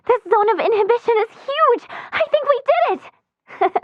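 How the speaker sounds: high-pitched, giddy excitement; gasp, then burst out with delighted triumph; elated at a breakthrough; thrilled discovery. Acted. Celebrating a research breakthrough.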